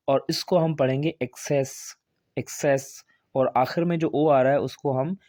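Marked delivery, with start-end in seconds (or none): 1.2-3.0 "एक्सेस" with k sound before s; hard C then soft. Shown as a demonstration. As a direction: say "एक्सेस" as ek-ses. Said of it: "पहला जो सी है वो काफ का sound देगा". ("एक्सेस" में पहले क, फिर स की आवाज़)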